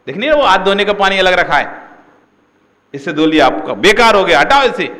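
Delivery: loud, fast, high angry rebuke; flaring up. Retold or imitated. Disciple flares up at the newcomer over the kalash, loud and angry. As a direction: blow up at him for the wrong water. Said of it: "तुम ऐसे उस पे भड़क गए".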